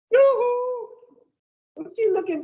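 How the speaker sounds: high sing-song yoo-hoo; teasing high call for attention. Real, not acted. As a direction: call a wandering listener back, high and bright. Calling out to a distracted listener.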